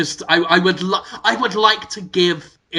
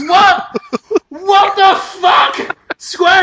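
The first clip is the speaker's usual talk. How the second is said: high, loud outraged shout; 11 semitones higher, 5 dB louder, much slower than usual. Real, not acted. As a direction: yell it in disbelief. Outraged at sales news.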